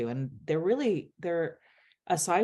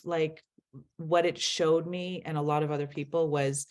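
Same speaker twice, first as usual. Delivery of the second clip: ordinary talk, nothing marked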